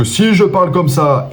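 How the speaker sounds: high, projected, drawn-out voice; loud volume demo. Shown as a demonstration. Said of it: "Le volume".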